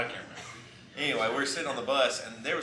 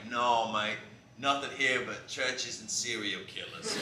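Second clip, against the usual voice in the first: slow, badly faked Australian drawl; laid-back mock Australian accent; 4 dB quieter, slower than usual. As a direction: do a very bad Aussie bus driver. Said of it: "I'll do a very bad Australian impression".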